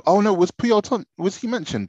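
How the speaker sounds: high startled oh no; sudden alarm. Real, not acted. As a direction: catch yourself, alarmed. Realises his pick may be taken.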